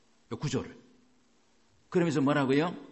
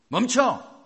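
loud, high shout to stop; sharp command; 8 semitones higher, 6 dB louder, much slower than usual. Acted. Preacher showing congregation how to halt bad thoughts, commanding shout. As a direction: halt a bad thought with one forceful cry.

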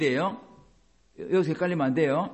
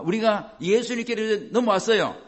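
normal talking voice

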